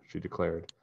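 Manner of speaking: plain reading voice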